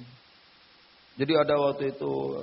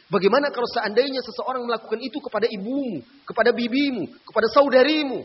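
loud, rising sermon appeal to the brothers; fiery preaching; 5 semitones higher, 8 dB louder than usual. Acted. Preacher urging the men listening, loud and building.

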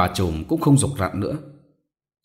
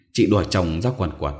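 plain reading voice

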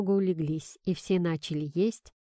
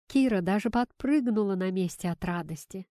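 ordinary delivery, nothing marked

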